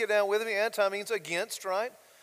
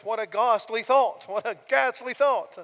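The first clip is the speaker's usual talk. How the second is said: a mock British gasp of horror, louder; 4 semitones higher, 7 dB louder than usual. Pastor quoting Phillips in a mock British voice, louder. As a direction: do a Brit appalled. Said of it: "you can just hear that".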